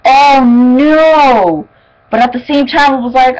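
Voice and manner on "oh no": louder, higher "oh no" of alarm; alarmed cry. Retold or imitated. Reacting to the camera catching the characters, a loud cry. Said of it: "I was like".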